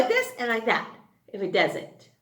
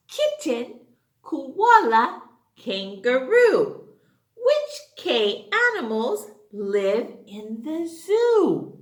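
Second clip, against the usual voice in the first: put-on children's poem recital; 2 semitones higher, 5 dB louder, slower than usual. Teacher reciting the K animals poem, sing-song. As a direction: chant it for little kids.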